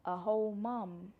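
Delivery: awed, slowed echo of the words; amazed disbelief. Real, not acted. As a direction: let A whole mum sink in, slowly. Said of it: "That sounds crazy when I say it out loud".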